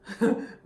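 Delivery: loud real laugh bursting out; sudden loud laugh; burst of laughter. Real, not acted. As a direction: laugh out at the memory. Laughing at the memory.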